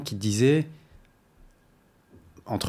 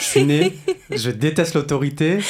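laughing; 5 semitones higher, 5 dB louder than usual. Retold or imitated. Quoting her with a laugh.

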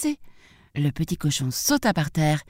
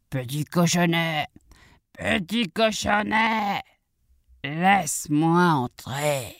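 slow, drawn-out wolf call; slower than usual. Acted. Wolf knocks at the piglet's door, slow drawn-out call. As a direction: stretch the syllables as the coaxing wolf.

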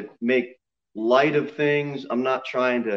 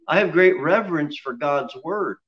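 ordinary delivery

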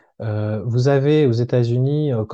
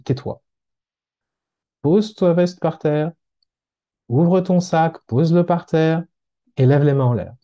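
lightly voiced stern orders, evenly pitched; a hint of a man giving commands, phrase by phrase; curt armed homeowner; 3 semitones higher than usual. Suspicious landowner to an unknown visitor on his property, stern orders.

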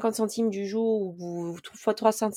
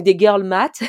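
put-on girl math punched louder in an overdone English accent; hammed-up English accent; 8 dB louder, slower than usual. Shown as a demonstration. Vlogger retrying the English term, louder hammed-up English accent. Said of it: "j'essaie de, d'avoir un accent anglais, mais c'est pas crédible".

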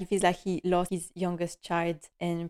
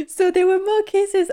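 laughing, much higher and louder; giddy laugh through the words; 12 semitones higher, 6 dB louder than usual. Real, not acted. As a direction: burst out laughing as you say it. Laughing at more kisses.

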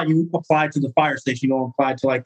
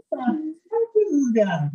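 mock baffled; 10 semitones higher than usual. Firefighters baffled by newcomer.

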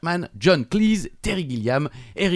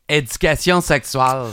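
put-on Québécois accent; 2 semitones higher, 5 dB louder than usual. Host mimics the title for his co-host, mock Québécois accent. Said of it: "quand je parle à des Québécois".

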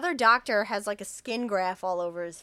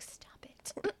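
hushed, whispered telling-off; imitated mother's whisper; whispered scolding; 10 dB quieter than usual. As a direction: whisper it sharply to shush the kids. Mother hushing laughing kids.

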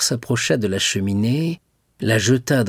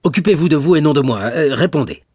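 fast, loud, high-pitched rebuke; irritated command; 5 semitones higher, 6 dB louder, faster than usual. A narrator's reading. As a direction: shut him down and demand an answer. Villefort snaps at Dantès. (ordre irrité et pressé)

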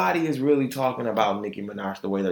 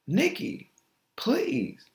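exasperated plea; 11 semitones higher, 4 dB quieter, much slower than usual. Real, not acted. Pleading with Nicki.

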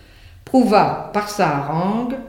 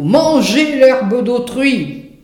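higher, loud and slow exclamation; outraged cry; 7 semitones higher, 6 dB louder, slower than usual. Acted. The animals' court condemning the donkey, outraged and loud. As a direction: cry out as if the crime were monstrous. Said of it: "Point d'exclamation".

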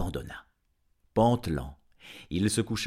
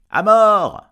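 very loud, high cry for blood; crowd shout; 17 dB louder than usual. A narrator's reading. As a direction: yell it as an impatient mob. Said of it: "crièrent les assistants". (cri de foule impatiente)

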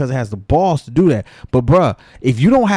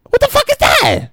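high squeaked disbelief; incredulous. Real, not acted. To viewers mocking a TikTok boast: high squeak of disbelief. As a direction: shoot up high in outraged disbelief.

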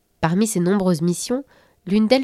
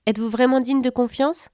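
attack him with doubt; suspicious challenge; 6 semitones higher than usual. Acted. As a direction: tooth fairy challenging the Belgian mouse. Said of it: "l'attaqua la petite souris".

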